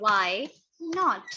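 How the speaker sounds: "why... not", a clear pause between the words; teacher's slow repeat of "why not"; word-by-word dictation. Shown as a demonstration. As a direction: say "why" and "not" separately, slowly.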